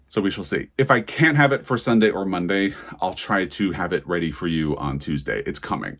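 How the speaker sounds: ordinary talk, nothing marked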